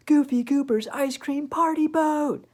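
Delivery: excited. Acted. The big party boat reveal.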